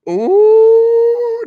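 sudden high loud ooh of disgust; shrill grossed-out ooh; disgusted squeal. Real, not acted. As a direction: yelp at something gross. Reacting to something nasty.

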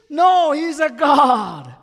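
very loud, slow, high declaration; firm shout. Retold or imitated. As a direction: proclaim it slowly, leaning on no. Voicing his own firm answer.

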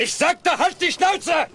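high, loud explosion of anger; enraged yell at the top of the range; furious shout. Acted. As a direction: bellow to silence him at once. Explodes at the raving man.

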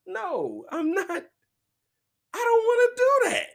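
high, loud refusing woman's voice; imitating ex-girlfriend. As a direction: squeal the refusal, fed up. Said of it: "she's like".

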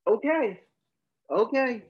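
high, loud, short okay; put-on reaction. Husband reacts to his wife's rejection, high loud put-on reply.

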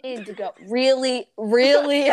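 the mom's fed-up really, really, half laughing; exasperated mom. Retold or imitated. Mom scolds her kid for the push, laughing exasperation. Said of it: "my mom was like".

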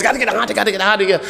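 high, hurried mock cry; frantic worshipper. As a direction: mimic the frenzy.

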